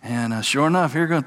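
usual speaking voice

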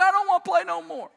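high, loud mock bawling; crying little boy. Crying boy refusing dad's pool game, imitated loud wail. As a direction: imitate a child wailing in refusal. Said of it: "He just kept crying".

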